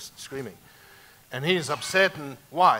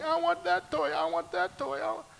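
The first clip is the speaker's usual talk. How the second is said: put-on much higher pleading toddler voice; whiny five-year-old. Four-year-old begging his dad for a toy, high whining. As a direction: whine for the toy like a small kid. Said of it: "freak out like a five-year-old".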